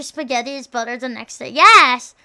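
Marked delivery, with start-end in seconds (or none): 1.5-2.1 loud, emphatic yes; emphatic agreement. Real, not acted. Streamer agreeing with chat about spaghetti, emphatic shout.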